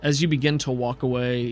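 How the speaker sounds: usual speaking voice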